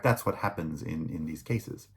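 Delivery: normal talking voice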